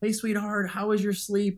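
put-on high, excited morning greeting to the kids; dad greeting his kids in the morning, excited; over the moon greeting. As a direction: greet them like you're thrilled to see them. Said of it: "I'm excited. My voice is raised".

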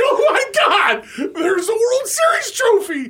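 cracking up while quoting the shock; laughing. Retold or imitated. Laughing retelling seeing trophy.

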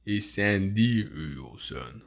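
slow, drawling "Hey, yo" tough guy; Sylvester Stallone impression. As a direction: drop into a Stallone mumble. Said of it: "That's my impression of Sylvester Stallone".

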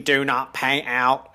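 slow robotic monotone; mock sci-fi robot warning; Dalek-like machine voice. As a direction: imitate a talking machine, word by measured word. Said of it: "the machine".

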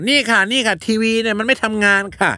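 high-pitched complaining mother. Retold or imitated. Son imitating his mother telling the repairman, high-voiced mother. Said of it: "แม่บ".

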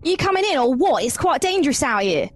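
high, slipping Cockney voice that drifts Australian; Cockney attempt. Character beckons the others inside, slipping Cockney accent. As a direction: call them in like a cheeky Londoner. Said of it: "going back to Australian again".

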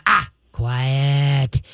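dog trainer's correction cue; firm correction. Shown as a demonstration. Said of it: "preface it with a".

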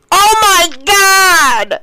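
high, slow disgusted squeal; shocked teen shriek. Retold or imitated. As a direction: shriek it like a grossed-out teenager. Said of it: "all you heard was".

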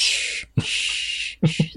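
long unvoiced shh; shushing. Co-host playfully hushing the other, drawn-out shushing. Hushing partner over cat burglar talk.

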